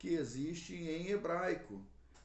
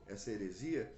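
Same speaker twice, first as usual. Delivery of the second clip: usual speaking voice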